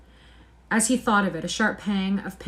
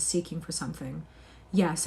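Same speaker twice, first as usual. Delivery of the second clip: ordinary delivery, nothing marked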